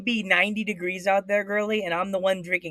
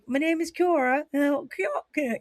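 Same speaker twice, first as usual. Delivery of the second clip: higher-pitched British accent attempt; Kiara; 8 semitones higher, slower than usual. Imitating British wrestler introducing herself, high British accent. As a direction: try out a British woman's name introduction. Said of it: "I have to do the Kiara".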